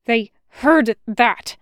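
an exasperated, drawn-out exclamation; urgent protest. A narrator's reading. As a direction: stress each word in alarm. Alarmed rebuke after whispering.

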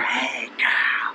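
breathy hushed greeting; stage whisper. Pretend. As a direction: whisper it like a secret. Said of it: "That's your stage whisper".

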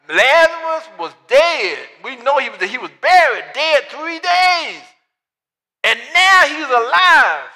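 loud, high and slow, drawn out; preaching at full force. Acted. Preacher proclaiming the raising of Lazarus, full-force preaching. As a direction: lift the congregation, slow and ringing.

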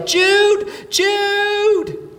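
long, high, loud call across a beach; yelling his name. Retold or imitated. Father searching the beach for his son, calling loudly. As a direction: call out for a lost child at full voice. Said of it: "and we yell".

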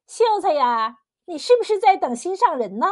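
put-on high, coaxing old busybody; lilting, nosy matchmaker tone; giggly teasing. A narrator's reading. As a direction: tease him playfully with a smile in the voice. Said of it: "笑嘻嘻地说".

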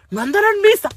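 voz aguda de tía despistada; tía imitada. Retold or imitated. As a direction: imita a la tía preguntando muy agudito. (pregunta ingenua con voz aguda)

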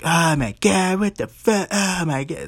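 imitating her worked-up outburst, pitched high; fired up. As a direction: play her already losing it. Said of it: "Angelique's already fired up".

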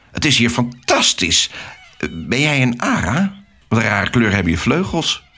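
put-on squawky cockatoo voices; cockatoos shouting. A narrator's reading. Cockatoos gather round the newcomer, mock screeching.

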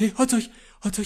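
quick high calling. Acted. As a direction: hail him hurriedly. Calling to hot dog.

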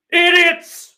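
high, loud scream of "Idiots!"; screamed insult. Real, not acted. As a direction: shriek the insult at them.

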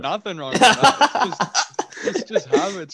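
laughing through the words; giggling, high and loud. Real, not acted. Laughing defense of celebrations.

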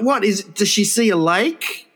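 ordinary talk

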